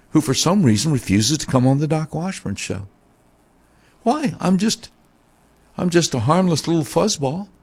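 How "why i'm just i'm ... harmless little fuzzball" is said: mock innocence. Mock innocent self-description.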